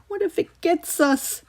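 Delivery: high, frightened little voice; a small boy afraid of the dark; voiced child trembling with fear; scared child. A narrator's reading. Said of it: "Kyle shook".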